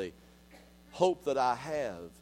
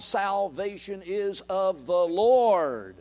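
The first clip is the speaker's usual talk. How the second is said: slow declaration; 2 semitones higher, much slower than usual. Shown as a demonstration. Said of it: "closing restatement".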